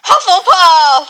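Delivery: Sorting Hat call. Pretend. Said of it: "can you do the Sorting Hat?".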